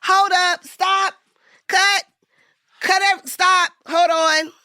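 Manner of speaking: high, loud reenacted shout; calling a halt. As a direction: yell to stop the whole scene. Retold yelling to halt filming.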